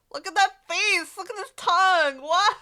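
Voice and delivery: high, loud grossed-out cry; loud disgust. Real, not acted. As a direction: yell out how gross it is. Disgusted reaction to character face.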